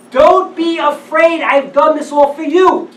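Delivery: preacher voicing God's promise to the listeners, slow and loud; God's reassurance. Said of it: "Read the last line".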